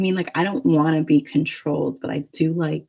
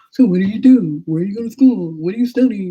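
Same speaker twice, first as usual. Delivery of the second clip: loud, higher stock questions; mock interviewing; 4 semitones higher, 7 dB louder, faster than usual. Mimicking first-chat questions to a match, mock eager. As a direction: fire off small talk like an over-eager interviewer.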